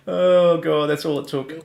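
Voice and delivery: usual speaking voice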